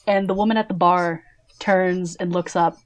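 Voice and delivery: ordinary talk, nothing marked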